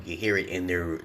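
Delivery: normal talking voice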